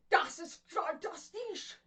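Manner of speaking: German "Das ist" spat out harshly; spitting German. Shown as a demonstration. Teacher joking about German, harsh spitting German. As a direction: spit the German words.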